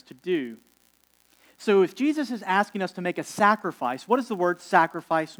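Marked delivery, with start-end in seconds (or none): none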